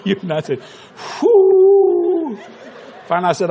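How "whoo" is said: high, loud nervous "whoo" of relief. Real, not acted. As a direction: blow out the nerves with a high "whoo". Nervous exhale before confessing.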